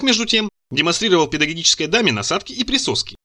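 usual speaking voice

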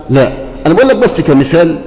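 ordinary delivery

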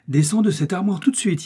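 high-pitched exasperated command; stern order. A narrator's reading. Father ordering his daughter out of the wardrobe, stern.

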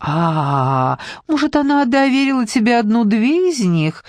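high, cooing flirtatious voice; coquettish lisping. A narrator's reading. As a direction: coo it sweetly, batting your eyes. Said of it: "просюсюкала она кокетливо".

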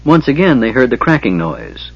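ordinary delivery

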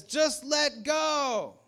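high, loud, long-drawn call; mysterious calling voice. Acted. Unseen voice answering the stranded climber, slow high holler. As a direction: holler it slowly like a far-off voice. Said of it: "you hear this voice".

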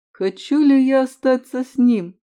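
usual speaking voice

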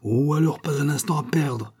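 alarmed call to hurry; urgent exclamation. A narrator's reading. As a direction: cry out that time is short. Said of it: "s'écria Gilbert".

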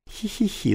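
high thin tee-hee giggle; a thin, high little giggle. A narrator's reading. Teacher giggling at the class, high thin giggle. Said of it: "заливался он тоненьким смехом".

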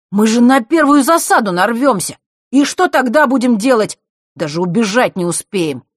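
put-on high, loud, outraged boy's voice; loud indignant complaint. A narrator's reading. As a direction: complain loudly and in alarm. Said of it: "громко возмущался Мишка".

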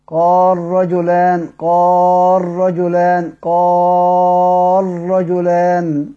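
very slow recitation of قال رجلان with lam merged into ra; slow tajweed reading of قال رجلان, lam assimilated. Shown as a demonstration. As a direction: stretch قال رجلان as qar-rajulan, the ra doubled. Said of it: "يبقى كيف نقرأها؟".

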